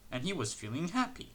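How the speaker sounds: plain reading voice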